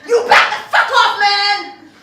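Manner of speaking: loud high angry yell; furious shout at the keeper. Retold or imitated. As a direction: bark him off. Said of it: "And I'm like".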